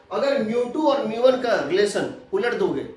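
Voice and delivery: ordinary delivery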